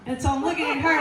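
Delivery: ordinary talk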